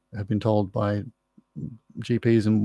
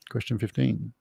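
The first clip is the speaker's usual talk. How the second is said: breathy unvoiced whisper, much softer; 8 dB quieter than usual. Real, not acted. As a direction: whisper the question number as a quick aside. Repeats the number, whispering it.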